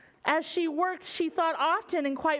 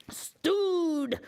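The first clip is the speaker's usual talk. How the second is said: mock pirate shout; 6 semitones higher than usual. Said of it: "shouted one pirate".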